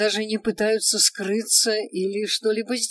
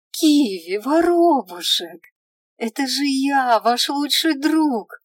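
pleading elf; 5 semitones higher, 7 dB louder, slower than usual. A narrator's reading.

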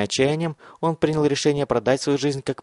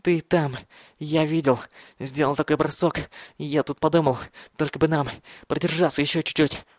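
gasping, higher, between breaths; wind knocked out; 4 semitones higher than usual. A narrator's reading. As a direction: wheeze it out while running. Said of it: "Задыхаясь, он прохрипел".

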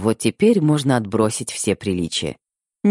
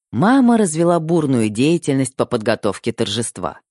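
usual speaking voice

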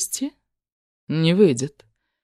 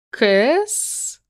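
mocking; 6 semitones higher than usual. A narrator's reading. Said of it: "с издёвкой произносит Пышка".

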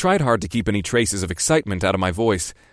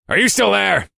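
much higher, louder impatient gruff boss; 11 semitones higher, 5 dB louder than usual. Acted. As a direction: demand an answer, annoyed.

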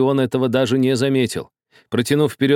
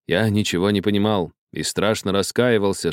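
usual speaking voice

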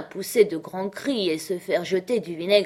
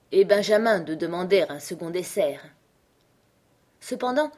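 usual speaking voice

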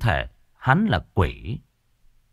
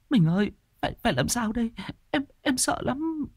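sniffling; 13 semitones higher, 5 dB quieter than usual. A narrator's reading. Wife sniffles to her husband, scared and tearful. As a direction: whimper it, still shaking. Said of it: "Tuyết sụt sùi".

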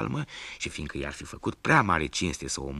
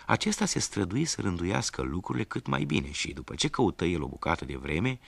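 ordinary delivery, nothing marked

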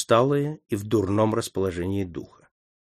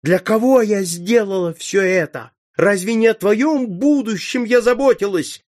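higher, louder anguished shouting; furious outcry; 11 semitones higher, 6 dB louder than usual. A narrator's reading. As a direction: countess shouting back at her son's accusations. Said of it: "кричала графиня". (гневный высокий громкий крик)

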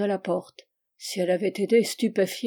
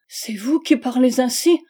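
higher and louder, rising in disbelief; a wife confronting him with indignant disbelief; 4 semitones higher, 7 dB louder than usual. A narrator's reading. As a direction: throw the accusing vous back at him. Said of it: "dans un mouvement d'indignation".

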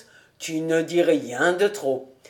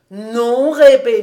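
loud, higher protest; emphatic denial; 4 semitones higher, 8 dB louder, slower than usual. Acted. Cobus denies inventing the fritters, emphatic. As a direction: call out a firm no.

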